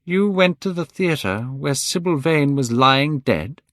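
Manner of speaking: slow, weighted disbelief; speaking very slowly. A narrator's reading. Basil confronting Dorian, very slowly. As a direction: draw each word out in horror. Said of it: "speaking very slowly".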